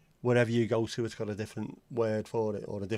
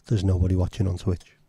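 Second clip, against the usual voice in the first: suddenly much louder and lower, blurting it out; loud correction; 5 semitones lower, 8 dB louder than usual. Acted. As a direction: bark the correction over the greeting. Denies the Twitch greeting loudly.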